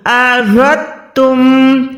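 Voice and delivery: अरदतुम slowly with दाल cut; correct pronunciation. Shown as a demonstration. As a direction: drop the दाल in अरदतुम, stretched slow. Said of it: "दाल को आपने यहाँ पर cut कर देना है". (दाल के बिना अरदतुम)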